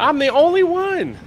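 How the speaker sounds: high, loud yelled protest; real yell. Real, not acted. Co-host protesting about who wears the merch, yelling. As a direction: yell it out indignantly, voice shooting up. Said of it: "Quit yelling at me".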